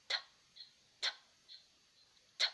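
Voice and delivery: bare T sound of "Ta", almost no vowel; isolated consonant sound. Shown as a demonstration. Teacher isolating the sound before A, bare T sound in "Ta". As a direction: clip "Ta" to just its first consonant, repeated with pauses. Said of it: "j'entends un autre son".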